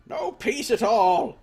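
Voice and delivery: put-on high, slow, grumbling old man rant; cranky old man. Gamer voicing the old villager, dismissing the soldiers. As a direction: rant like a stubborn old hermit. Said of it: "let's talk to Old Man Mua".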